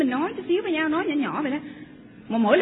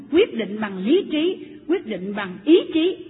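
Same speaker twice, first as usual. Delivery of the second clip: slow and louder, every word weighted; slow emphatic; 2 semitones lower, 4 dB louder, much slower than usual. Real, not acted. Preacher insisting to the couples, slow and emphatic. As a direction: drive each word home deliberately. Preacher hammering home a point.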